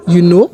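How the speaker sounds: short "You know?" with KNOW rising; statement words asked by lifting "know"; question intonation. Shown as a demonstration. Teacher showing a simpler way to ask, question intonation. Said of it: "I can simply say".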